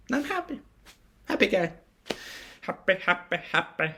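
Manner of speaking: high, silly happy-guy voice; mock happy voice. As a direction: chirp it in a put-on high pitch. Clowning a happy person.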